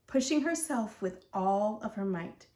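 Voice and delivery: ordinary delivery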